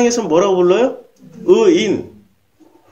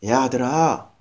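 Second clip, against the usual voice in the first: tender father; 7 semitones lower, 5 dB quieter, slower than usual. Retold or imitated. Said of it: "아버지가 혼내든가요?".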